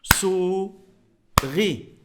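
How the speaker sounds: "Souris" as sou, long pause, ri; sou... ri for "Souris", clapping-drill style; tapped-out syllables. Shown as a demonstration. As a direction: beat out "Souris" in two separate syllables. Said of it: "Tapez avec moi".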